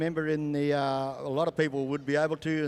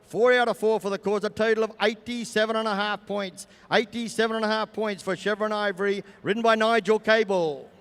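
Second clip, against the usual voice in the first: arena announcer; 4 semitones higher, 4 dB louder than usual. Acted.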